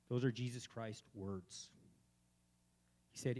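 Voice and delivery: normal talking voice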